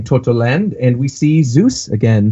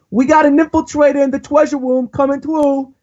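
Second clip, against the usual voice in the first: put-on delinquent kid voice; 12 semitones higher than usual. Reading a manga kid's line to friends, high cocky punk voice. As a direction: play a swaggering little delinquent, pitched way up. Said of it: "And he goes".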